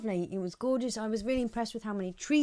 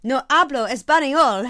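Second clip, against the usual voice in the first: mock Spanish; 5 semitones higher, 11 dB louder than usual. Speaking Spanish with flourish.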